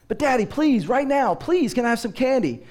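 put-on small boy pleading over and over for candy; pleading child. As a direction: push the begging up a notch. Child begs harder.

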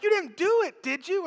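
high, disbelieving mock question; aghast friends. Friends asking the widow if she buried the money, high mock alarm. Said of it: "And they're like".

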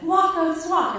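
high, loud urging command; commanding goats. Retold or imitated. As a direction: order the animals to get moving. Ordering goats to move.

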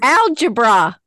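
loud punchline with a grin; corny dad joke punchline. Pretend. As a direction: deliver the pun proudly. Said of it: "It's total dad joke".

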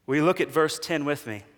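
ordinary delivery

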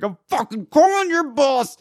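high, strained angry mock scream; imitated screaming. Irate homeowner threatens the worker, screaming high. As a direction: screech the threat like an irate customer. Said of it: "he's fucking screaming at me".

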